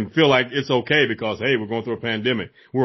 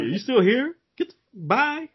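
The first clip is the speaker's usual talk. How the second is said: high-pitched, incredulous send-off; mock dismissal; 12 semitones higher than usual. Shooing off a commenter who announced unfollowing, mock dismissal. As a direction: act surprised they are still hanging around.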